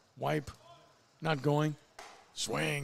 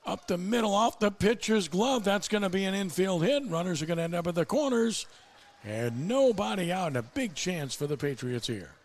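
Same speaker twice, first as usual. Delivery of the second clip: higher, lively live baseball call; excited play-by-play; 6 semitones higher, 4 dB louder than usual. Real, not acted. Announcer calls an infield hit for listeners, excited play-by-play. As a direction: call the action as it happens, pitch up.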